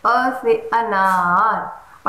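slow, drawn-out "अ से अनार"; careful teaching pronunciation. Shown as a demonstration. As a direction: stretch "अनार" out slowly for a child. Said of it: "उसको proper सिखाइए".

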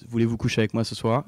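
"Voulez-vous coucher avec moi ce soir?" quick and fluent; real French pronunciation. Shown as a demonstration. French speaker giving the real phrase, fluent and quick. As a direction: run it together like a native French speaker. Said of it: "so the real thing is".

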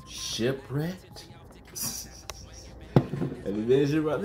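ordinary delivery

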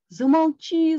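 high, loud desperate yell. A narrator's reading. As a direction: cut the voice off with a shout. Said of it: "завопил жаб".

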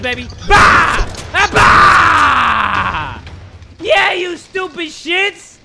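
high, loud yelled taunts; trash-talk shouting. Real, not acted. Blasting the naked cultists, he hollers taunts. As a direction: roar insults while firing.

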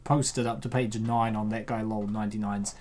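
normal talking voice

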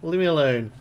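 loud pleading yell; desperate shout. Real, not acted. Streamer begging the janitor to leave, loud desperate shout. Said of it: "You sound like a demon".

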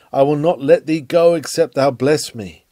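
ordinary talk, nothing marked

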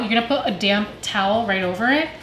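ordinary delivery

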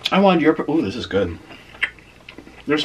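chewing while talking; savoring a bite mid-sentence; mouth full of pizza. Real, not acted. Seen 1: Holds bitten slice; cheeks puffed, chewing motion through most of the words.

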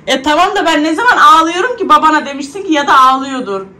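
high, loud teasing protest to the daughter; playful protest. Real, not acted. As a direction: tease her back, up and loud. Teasing reply to daughter's message. (kızına yüksek sesle şakacı itiraz)

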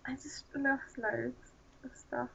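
slow, hushed, half-whispered dismay; dejected. Real, not acted. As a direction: trail into a small whisper of loss. Dejected after losing game items.

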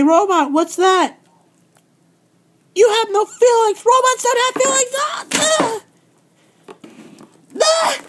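high, loud, frantic screams at full force; hysterical screaming. Acted. Champion shrieks in a panic as her belt is taken. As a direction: shriek like a tantrum that won't stop.

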